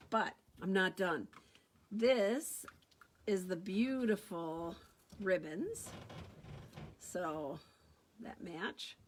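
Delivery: ordinary delivery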